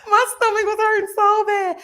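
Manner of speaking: high, loud laughing through the line; real laughter, high and breaking. Real, not acted. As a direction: laugh it out, almost crying. Laughs recalling stomach ache.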